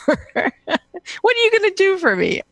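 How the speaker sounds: sassy scoff at the husband's question; mock scornful retort. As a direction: toss it back as if the idea is absurd. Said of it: "I go".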